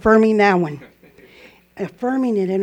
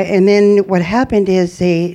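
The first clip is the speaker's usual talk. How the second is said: ordinary delivery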